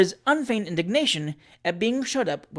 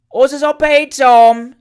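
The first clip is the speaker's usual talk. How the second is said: high, very loud scream; 9 semitones higher, 13 dB louder, much slower than usual. Acted. Landlady shouting to the post-boy, loud scream. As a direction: scream it after the chaise. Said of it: "screamed Missus Williamson".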